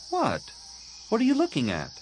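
high-pitched, self-conscious challenge; defensive girl. Acted. Tahiri catches her friend staring, defensive. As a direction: pitch it high, like a young girl caught off guard.

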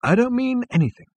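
dazed, high-pitched disclaimer; dazed reply. Acted. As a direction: answer as if glazed over. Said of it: "with a glazed look in his eyes".

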